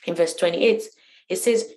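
ordinary talk, nothing marked

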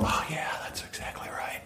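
dreamy whispered agreement. Retold or imitated. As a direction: sigh it out in a whisper. Imagined customer reaction.

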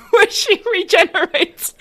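high, loud, slow squeal through laughter; laughing delight. Real, not acted. Fan pitching a silly crossover theory, laughing. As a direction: crack up while saying it.

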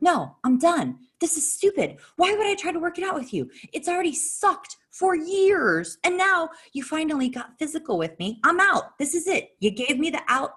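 high-pitched, exasperated retold tirade; indignant breakup speech, acted out; angry refusal. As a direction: re-enact putting your foot down, voice pitched high. Retold quarrel, ending the marriage.

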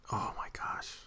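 whisper. Real, not acted. Dreaming of the robe.

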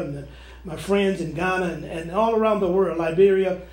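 hushed intercessory prayer. Acted. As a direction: pray quietly for the suffering. Leads a prayer.

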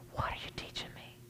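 whispered, humble question to God; hushed prayer. Acted. Preacher models asking God mid-trial, whispered prayer. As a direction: drop to a whisper as in private prayer.

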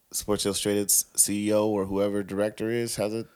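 ordinary talk

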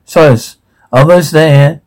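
ordinary talk

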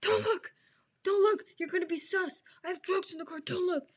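high, loud frantic hissing warnings; mock panicked yelling. Friend imitates paranoid driver with drugs, panicked yelling. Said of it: "He's like".

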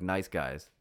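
ordinary talk, nothing marked

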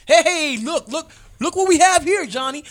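high, loud mocking cop voice; cocky young cops.

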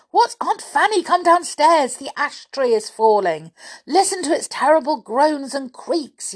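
high, urgent yell. A narrator's reading. Boy urging aunt downstairs from falling tree, yelling. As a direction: cut in with a frantic warning. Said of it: "yelled Julian".

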